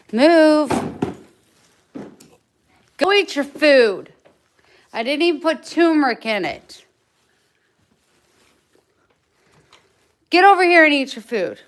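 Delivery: loud, high, fast bossing; loud commands. Real, not acted. Owner loudly ordering her dog to go eat. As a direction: boss the dog to his food.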